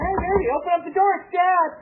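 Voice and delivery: dad calling through door. Retold or imitated.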